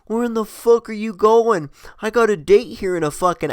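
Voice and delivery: retold pleading shout down the street; calling after her. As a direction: call out to someone walking off ahead. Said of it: "chasing her".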